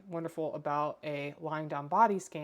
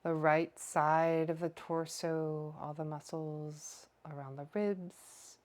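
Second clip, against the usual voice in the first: guided meditation. Acted. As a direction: draw out each phrase, leaving room to breathe. Leads a lying body scan.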